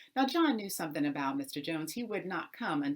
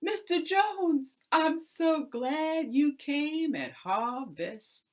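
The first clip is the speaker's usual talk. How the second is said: high, slow, sing-song greeting; sly tenant, delighted; 6 semitones higher, 6 dB louder than usual. Acted. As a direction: gleefully show off the wheat. John springs the trick.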